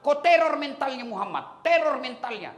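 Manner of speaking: high, loud, hard command; scheming order. Retold or imitated. Abu Jahal ordering his hired thug, high and loud. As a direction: bark the order like a villain.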